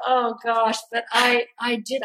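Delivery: ordinary talk, nothing marked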